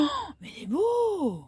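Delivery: excited. Real, not acted. Marvelling at the crab.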